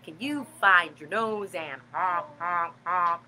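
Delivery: low, goofy honking voice; silly clown. Acted. Honking nose in kids song.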